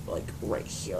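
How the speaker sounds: low whispered aside. Real, not acted. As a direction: drop to a whisper, pointing it out. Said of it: "whispered aside".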